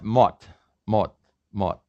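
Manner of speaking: "mot" ending in a stopped T; final S as T. Shown as a demonstration. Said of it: "And this is pronounced".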